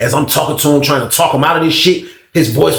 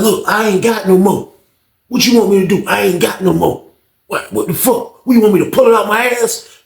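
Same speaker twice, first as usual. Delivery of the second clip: defensive retort; 8 semitones higher than usual. Retold or imitated. Old head snapping back at Fred, high and defensive. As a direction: throw it back at him.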